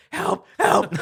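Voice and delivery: high, loud fake cry for help; mock scream for help. Kid prank-calling police, mock scream for help. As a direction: shriek like a kid playing emergency. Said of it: "pretend to call the police and scream".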